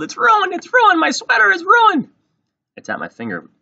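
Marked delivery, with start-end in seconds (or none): 0.0-2.1 very high, loud shrieking wail; screeching meltdown at full volume; yelling. A narrator's reading. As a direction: shriek it like a disaster, stretching the last line. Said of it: "she yelled".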